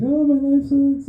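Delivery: high, slow, mock-miserable whine; a hammed-up sad caller on the hotline; whiny caller. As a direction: play a caller moaning about life. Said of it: "they're like".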